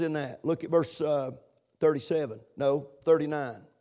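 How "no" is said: usual speaking voice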